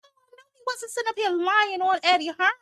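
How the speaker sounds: high sarcastic mock gasp; mock outrage. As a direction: scoff at him in a high voice. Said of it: "I said".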